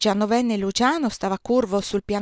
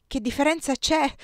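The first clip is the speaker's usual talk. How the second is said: moaning; 7 semitones higher than usual. A narrator's reading. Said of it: "gemette Madame Bonaparte".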